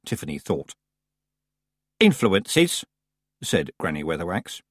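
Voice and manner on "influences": high, chilly echo of the word; icy. A narrator's reading. Granny throws the rival's word back, icy. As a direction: freeze the word into a challenge. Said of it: "a voice so icy".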